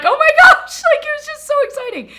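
very high, loud excited squeal; re-enacted joyful shriek, racing on; thrilled squeal. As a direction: squeal it at the top of your range. Re-enacts thrilled reaction with husband.

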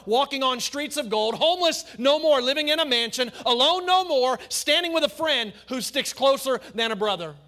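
loud, climbing pulpit delivery; full-force preaching. Acted. Pastor thundering at church about heaven and hell, at full force. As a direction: preach it hard to the congregation. Preacher builds the no-more list.

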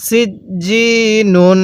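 slow সিজ্জিনুন with firm j and long i; drawn-out recitation. Shown as a demonstration. Teacher shows how it must be read, slow stretched সিজ্জিনুন. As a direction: stretch সিজ্জিনুন evenly on a held note. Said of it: "কিভাবে পড়তে হবে".

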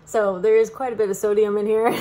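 usual speaking voice

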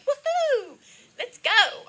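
high, loud party cheer; excited whoop. Retold or imitated. Describing the light's energy, a loud high whoop. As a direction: shout it like a Mardi Gras reveler. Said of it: "'cause it was like".

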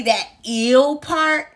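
ill drawn out slowly, like the word "ill"; slow, deliberate teacher demo of ill; slow model of ill. Shown as a demonstration. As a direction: stretch out ill to show the sound. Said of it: "is how you pronounce the beginning".

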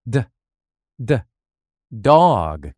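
the D sound spelled out, then dog; phonics drill. Shown as a demonstration. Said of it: "Repeat after me".

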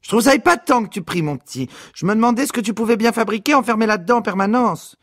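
mother praising her son, fussy. A narrator's reading.

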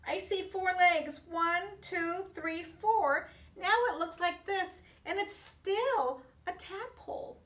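high and loud counting; thrilled, showing the kids; excited. Acted.

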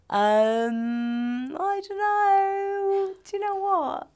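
very high, drawn-out stalling; stumped. Real, not acted. Guest stumped by the favourite-word question, stalling in a high voice. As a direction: stretch it out high while searching for an answer.

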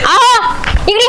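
slow wifely call. Acted. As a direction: summon him over, stretching the words. Wife calls husband over.

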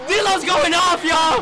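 high, jubilant yell; excited shout. Real, not acted. Fan announcing D'Lo is going off, high excited shout. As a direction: crow about the hot streak. Celebrating player's hot streak.

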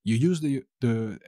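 normal talking voice

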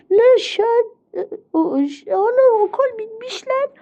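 high-pitched excited discovery; play-acted astonishment. Pupil noticing school is over, high amazed cry. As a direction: blurt it high, stumbling over the words.

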